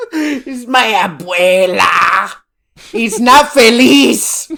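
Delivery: slow, drawn-out mock Mexican accent; hammed-up Mexican accent through laughter. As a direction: parody a Mexican cartoon iguana, stretching each phrase. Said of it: "fucking Speedy Gonzalez ass".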